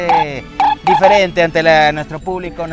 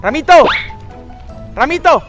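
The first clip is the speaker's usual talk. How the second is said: calling out; 6 semitones higher than usual. Acted. Calling across the field.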